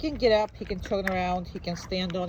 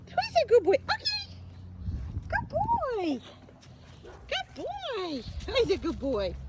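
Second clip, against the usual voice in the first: sing-song, much higher praise for a puppy; high-pitched dog praise; 12 semitones higher, 3 dB quieter than usual. Acted. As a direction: gush over the good boy like baby talk. Praising the dog in pet talk.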